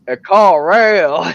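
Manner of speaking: exaggerated hillbilly drawl. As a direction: ham up "A call rail" in a thick Arkansas drawl. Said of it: "And he's like".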